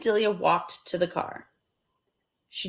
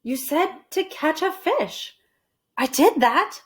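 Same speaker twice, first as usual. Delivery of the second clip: higher, slow matter-of-fact; Amelia Bedelia voiced, innocent; 8 semitones higher than usual. Amelia Bedelia explaining to Mister Rogers, innocent. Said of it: "she said".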